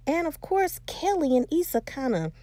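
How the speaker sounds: ordinary talk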